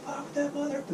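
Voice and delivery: high, soft mock protester snarl; a lightly hammed-up angry protester. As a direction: sneer it like a caricatured protester.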